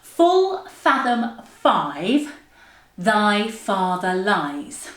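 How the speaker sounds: very slow, separated, swooping words; tongue-twister demo. Shown as a demonstration. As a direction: articulate each word by itself with big intonation. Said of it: "So it goes like this".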